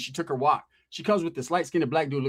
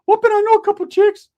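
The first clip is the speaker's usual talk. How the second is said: much higher, loud squeaky cousin voice; Rick's squeaky voice; 9 dB louder than usual. Retold or imitated. Cousin Rick answering, high loud squeaky voice. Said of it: "He's like".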